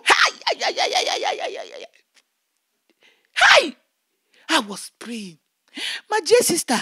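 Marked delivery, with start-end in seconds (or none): 0.0-3.8 lightly voiced high cackle of a taunting spirit; a hint of an evil spirit's sneering laugh; high mocking hee-hee. Spirit woman laughs at him.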